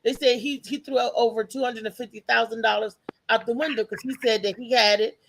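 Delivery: ordinary talk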